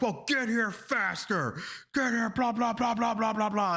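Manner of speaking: high, pushy phone rant; mock yelling boss. Boss hurrying late employee by phone, high-pitched mock yelling. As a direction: imitate a boss hollering, running into blahs. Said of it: "like, yelling at her".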